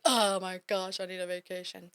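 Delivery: hammed-up exhausted exclamation, dropping away; dramatic. Business owner after spring rush, mock-dramatic plea. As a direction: play up the need for a break, then sag. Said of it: "It's very dramatic".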